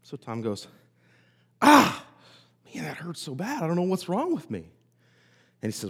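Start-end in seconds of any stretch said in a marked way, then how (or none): 1.6-4.7 high yelp of pain, then moaning complaint; hammed-up cry of pain. Joke patient Tom yelps when the doctor prods, hammed-up pain.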